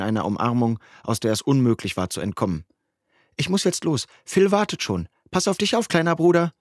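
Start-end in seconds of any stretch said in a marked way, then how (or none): 3.4-6.5 put-on higher, affectionate older sister; higher sister's voice, fussing over her little brother; big sister's voice. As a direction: pitch up for the caring sister saying goodbye.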